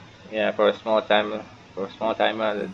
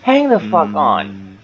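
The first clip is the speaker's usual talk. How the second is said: loud, slow, cut-off disbelief; real shock at the screen, louder and slower; startled outburst; 4 semitones higher, 9 dB louder, slower than usual. Real, not acted. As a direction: stop everything, something's off. Streamer startled by game scene.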